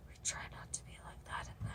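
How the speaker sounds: giggly whispered aside; hushed laughing whisper. Real, not acted. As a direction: whisper the joke under your breath. Joking aside after swearing.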